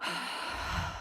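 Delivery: long, deep, audible sigh out; big sigh. Shown as a demonstration. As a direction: let a heavy sigh go. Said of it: "just let out a, a big sigh".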